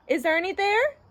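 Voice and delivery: high-pitched phone caller; caller's voice. A narrator's reading. Caller asks Bert for Ernie, high-voiced. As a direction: ask it in a high put-on voice. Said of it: "said the voice on the phone".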